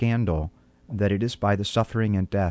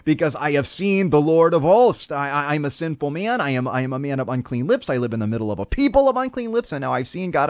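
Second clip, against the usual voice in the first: higher, hammed-up panic, half laughing; retold prophet crying out in mock dread, pitched up; mock terrified scream; 6 semitones higher, 3 dB louder than usual. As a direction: play a man sure he is about to die, in mock terror. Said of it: "Isaiah screams out".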